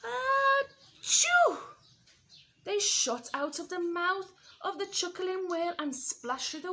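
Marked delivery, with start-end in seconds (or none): 0.0-1.6 very high, loud achoo; huge sneeze. Acted. Whale sneezing George free, a huge sneeze. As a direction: sneeze it out big.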